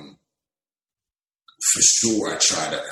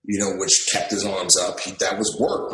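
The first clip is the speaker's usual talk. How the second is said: ordinary delivery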